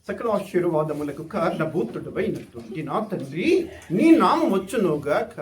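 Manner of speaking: a hint of a pompous prayer; stiff formal prayer. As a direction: intone it like a showy churchgoer. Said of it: "ప్రార్థన సరికంటే".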